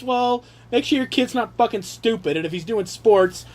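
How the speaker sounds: normal talking voice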